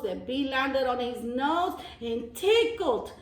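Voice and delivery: plain reading voice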